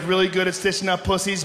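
usual speaking voice

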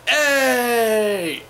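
high loud shouted Hey; Miz showing off. Retold or imitated. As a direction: belt out Hey like a cocky wrestler to the crowd. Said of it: "So The Miz will be like".

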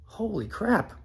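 stunned disbelief. Retold or imitated. Caller on hold, stunned and quiet. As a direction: mutter it in shock. His reaction while on hold.

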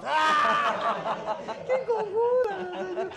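very slow, high words through real laughter; laughing at the silly riddle. Real, not acted. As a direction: stretch it out while laughing hard.

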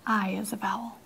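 breathy stage whisper to the class. Shown as a demonstration. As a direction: hush it like a secret. Teacher mock-whispers to class.

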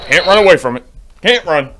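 loud, high alarmed cry; panicked player fleeing a monster; panicked shout. Real, not acted. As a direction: yell in panic while fleeing. Chased by monster.

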